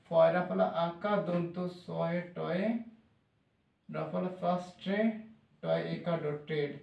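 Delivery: slow, soft letter-by-letter spelling; careful slow dictation to a student. Shown as a demonstration. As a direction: spell each letter slowly and softly. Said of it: "teacher spells the word slowly".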